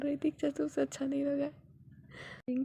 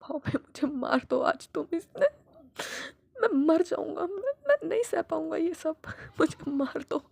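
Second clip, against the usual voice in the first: loud, much higher anguished cry; despairing outburst; 6 semitones higher, 6 dB louder than usual. Acted. Father crying out in despair at his son, high and anguished. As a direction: break down begging to be killed.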